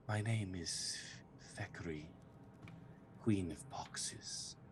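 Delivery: soft eerie whispered queen voice; sickly sweet whisper. Acted. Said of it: "the sickly sweet voice whispers to you".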